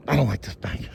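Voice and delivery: usual speaking voice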